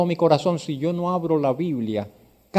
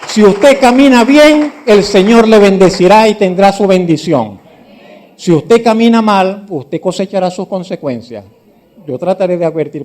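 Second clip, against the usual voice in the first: loud, high preacher's warning; preaching at full force; 4 semitones higher, 9 dB louder than usual. Acted. Pastor warning the church against judging, preaching loudly. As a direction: cry it out over the congregation.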